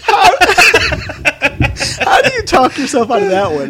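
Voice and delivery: high and loud through laughter; laughing. Real, not acted. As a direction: get it out while cracking up. Laughing at own story.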